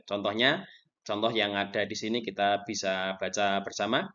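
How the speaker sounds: ordinary talk, nothing marked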